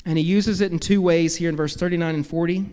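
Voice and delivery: usual speaking voice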